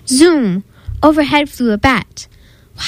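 ordinary delivery, nothing marked

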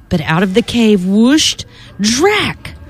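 playful storybook narration with spooky sound words; reading to children. Acted. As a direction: read it like a bedtime story for kids. Reads Halloween picture book aloud.

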